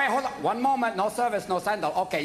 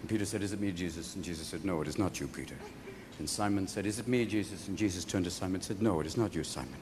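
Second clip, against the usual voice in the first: very deep, quiet, reverent storytelling; solemn biblical narration; 8 dB quieter than usual. Acted. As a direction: drone it like a somber scripture reading.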